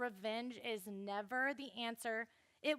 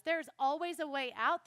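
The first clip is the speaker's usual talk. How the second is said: usual speaking voice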